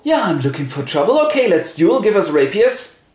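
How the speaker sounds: quick, high-pitched comeback; hot-headed retort, demanding a duel; defiant challenger. Acted. Acted quarrel leading to duel.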